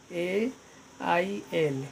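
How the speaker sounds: ordinary delivery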